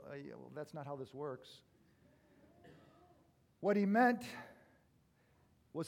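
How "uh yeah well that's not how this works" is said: quiet. Retold or imitated. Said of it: "we were like".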